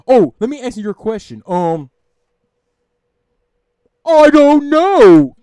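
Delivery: mock a silly streamer dodging the question; goofy mocking voice. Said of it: "trying to be goofy and over the top".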